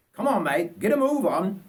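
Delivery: put-on impatient old Bert Booth. Old bushman hurrying Richard with his cases, high and impatient. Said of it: "said old Bert Booth".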